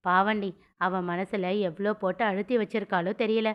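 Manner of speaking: plain reading voice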